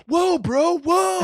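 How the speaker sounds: high-pitched imitation of a caught buddy; startled protest. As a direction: act out being walked in on. Voices buddy caught having sex.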